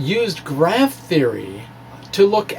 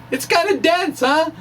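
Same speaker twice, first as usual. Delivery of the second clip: chuckling amused remark, pitched up; laughing; 13 semitones higher than usual. Real, not acted. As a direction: laugh through it at the dense chart. Joking about dense diagram.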